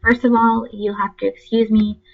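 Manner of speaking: ordinary talk, nothing marked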